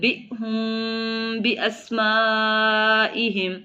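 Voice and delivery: slow, drawn-out Quranic chant with held vowels; Quran recitation. Shown as a demonstration. Teacher reciting the verse for students, slow tajweed chant. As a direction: recite it slowly like tilawat. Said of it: "teacher recites verse in tajweed".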